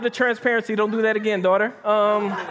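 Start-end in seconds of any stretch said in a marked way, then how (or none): none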